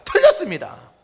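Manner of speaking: very loud, high-pitched declaration; thundering verdict. Real, not acted. Preacher answering his own question to the congregation, thundering verdict. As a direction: knock the wrong idea down hard.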